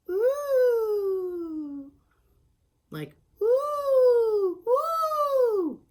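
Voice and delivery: very high, loud "ooh" swooping like a siren; siren noise. Shown as a demonstration. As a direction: wail it out like an alarm siren. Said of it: "That's basically a siren noise".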